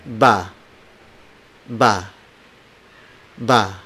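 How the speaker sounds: "Ba" with no puff of air after B; unaspirated B. Shown as a demonstration. Teacher models the letter B, "Ba" without aspiration. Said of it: "these consonants are unaspirated".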